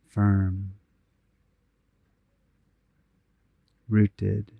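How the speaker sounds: unhurried, even meditation voice with long pauses; guided meditation. Real, not acted. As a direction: guide them gently inward, phrase by phrase, leaving space to breathe.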